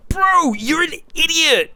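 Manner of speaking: very high-pitched, incredulous put-down; exasperated insult. Real, not acted. Streamer answers commentator's fragile remark, exasperated. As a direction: throw the insult in squeaky disbelief.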